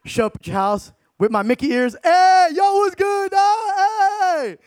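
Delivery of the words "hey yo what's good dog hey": much higher and louder call at the door; loud hype greeting. Acted. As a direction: holler for your buddy outside his house. Arriving at friend's house, calling out.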